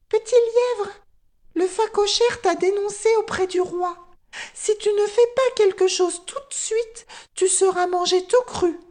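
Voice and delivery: very high, piping bird voice; tiny high-pitched creature; little guinea fowl. A narrator's reading. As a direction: squeak an urgent warning to a friend. Guinea fowl warns her friend.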